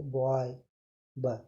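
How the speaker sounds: बॉय said whole, then only its first sound ब cut short. Shown as a demonstration. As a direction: break बॉय down to its opening ब for the pupils. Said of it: "बॉय को बोल के देखो". (बॉय को तोड़कर पहली ध्वनि ब पर ज़ोर)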